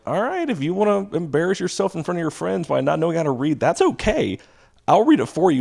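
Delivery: sarcastic teacher taunting a student who won't read; mock-helpful teacher. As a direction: needle him with fake kindness. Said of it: "I was like".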